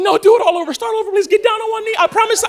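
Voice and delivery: high, loud begging; mock excited pleading. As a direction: imitate a thrilled girlfriend demanding a redo. Acts girlfriend begging for redo.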